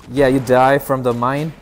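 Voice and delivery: normal talking voice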